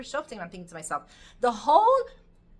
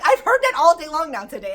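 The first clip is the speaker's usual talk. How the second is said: louder, fed-up complaint; exasperated son; 5 semitones higher, 12 dB louder than usual. Retold or imitated. Son complaining to his father, loud fed-up complaint. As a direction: complain loudly, sick of hearing it. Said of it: "He's like".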